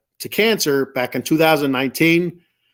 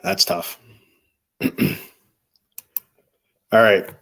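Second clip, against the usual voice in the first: choked up; 6 semitones lower than usual. Real, not acted. Choked up over sick kids.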